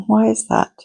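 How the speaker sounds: ordinary delivery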